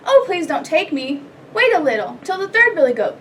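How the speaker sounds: earnest, lilting storybook plea; pleading middle goat. A narrator's reading. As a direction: plead as the second billy goat. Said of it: "his voice was not so small".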